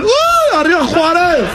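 aullido agudísimo de alegría y vítor; grito festivo. Acted. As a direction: suelta un grito de mariachi, muy agudo. [name] imitando a [name]. (grito agudo y festivo)

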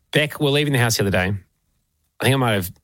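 normal talking voice

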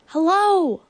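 high, falling holler; shouted call. A narrator's reading. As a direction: call out across a great distance. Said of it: "again he shouted".

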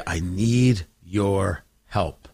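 very low, slow mock begging; slow, low, grudging plea; hat-in-hand plea. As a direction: imitate a humbled man asking for help, word by word. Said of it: "come to him hat in hand".

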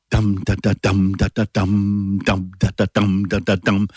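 chanted dum da da, heavy first beat; dactylic beat. Shown as a demonstration. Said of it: "I can give you an example of th-, what the rhythm sounds like as a beat".

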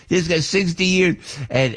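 ordinary talk

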